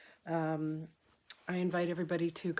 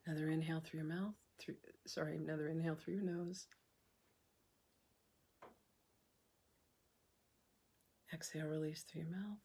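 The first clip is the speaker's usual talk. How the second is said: soft, unhurried meditation voice with long pauses; hushed, calm breathwork leader; guided meditation; 7 dB quieter than usual. Acted. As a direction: guide them gently through each breath, leaving room to breathe.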